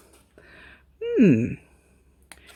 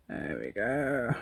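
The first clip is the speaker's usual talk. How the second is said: posh lady voice, much lower and softer; 7 semitones lower, 9 dB quieter, slower than usual. Acted. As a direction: put on a plummy lady voice. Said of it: "I was doing posh voices".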